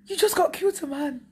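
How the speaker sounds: high-pitched mock British accent; imitated British accent. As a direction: quote it in a high put-on British voice. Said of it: "Avec un accent british là".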